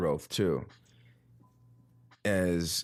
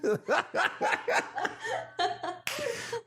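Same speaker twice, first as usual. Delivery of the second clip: high laugh. Real, not acted. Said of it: "talking like this".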